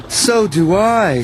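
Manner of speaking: high, slow sickly groan; a mild ill moan. Acted. Mavis breaking down too, groaning. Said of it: "she groaned".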